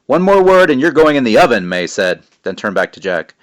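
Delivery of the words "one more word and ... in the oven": threatening. Acted. Threat to witch.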